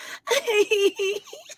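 high-pitched imitation giggle; mock a cutesy, put-on titter; exaggerated high girlish-cute laugh, mimicking; fake cutesy laugh. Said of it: "That fake cutesy, like".

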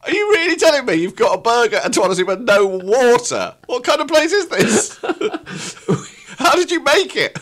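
high, laughing, argumentative survivor. Acted. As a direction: argue back in a high voice, half laughing. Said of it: "Still fucking argumentative".

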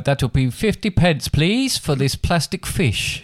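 high, slow shop till voice with a big jump on please; pretend shopkeeper. Dad playing a shopkeeper at a toy till. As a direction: play a cheery toy-shop cashier. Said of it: "plastic tills you get in the early learning centre".

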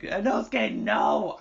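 high mock-anguished call; distraught cry. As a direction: plead with the screen in dismay. Retelling own cry to the screen.

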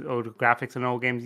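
ordinary talk

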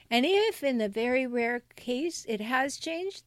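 ordinary talk

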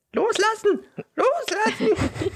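high, squeaky, drawn-out gnome. Retold or imitated. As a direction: shriek it like a tiny gnome. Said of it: "der spricht den Gnom noch".

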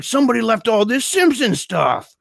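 high, imitated holler; taunting shout. Man luring the kids out of hiding, taunting shout. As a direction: call it out to hidden kids as bait. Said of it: "he's screaming and it's echoing in the woods".

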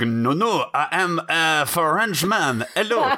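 bad put-on French accent; mock French accent. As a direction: play an Italian faking a Frenchman, French accent. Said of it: "[name] puts on an English accent".

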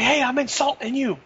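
high-pitched put-on protest; ignored bully. Co-host playing the bully nobody listened to, mock-offended.

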